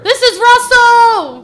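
loud, high drawn-out chant; war cry. Acted. Players chanting the name as a battle cry, loud.

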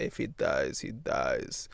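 put-on cold, deadpan Russian accent like a hardened boxer; low, half-whispered Russian accent; Russian hard-man accent. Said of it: "can he have that accent".

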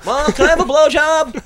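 put-on high, far-off kid voice begging mom; whiny kid. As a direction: play a little kid pestering his mom.

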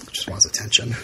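whispered snide aside; whispering. Real, not acted. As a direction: breathe it out under your breath. Muttered jab at buddy.

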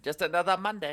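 high, loud, hammed-up Chinese accent; a badly faked Chinese accent. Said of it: "that was the worst Chinese accent".

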